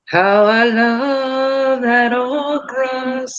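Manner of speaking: normal talking voice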